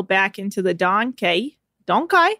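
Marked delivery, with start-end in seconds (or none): 1.9-2.3 rising puzzled question. Real, not acted. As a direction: question the odd word. Puzzled at odd word.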